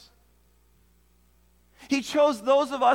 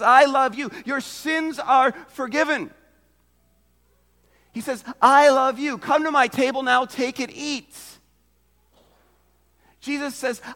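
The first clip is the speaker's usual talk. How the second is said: fervent proclamation; 7 dB louder than usual. Acted. Preacher voicing Jesus' declarations.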